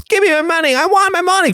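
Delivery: very high squeaky demanding voice; shrieking high-pitched customers demanding their money; demanding crowd. Acted. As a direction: squeal it like a panicked bank customer.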